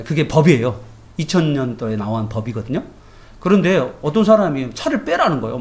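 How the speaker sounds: usual speaking voice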